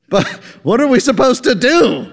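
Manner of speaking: high, loud exasperated question; exasperated coworker. Acted. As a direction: throw up your hands. Rogler ranting at lunch.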